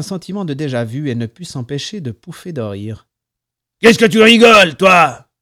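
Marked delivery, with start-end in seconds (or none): 3.8-5.3 high, loud threatening shout; furious bellowed challenge; angry yell. A narrator's reading. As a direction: round on them yelling. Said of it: "hurla le barbu".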